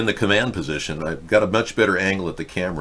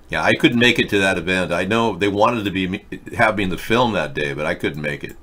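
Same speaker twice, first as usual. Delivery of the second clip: ordinary talk, nothing marked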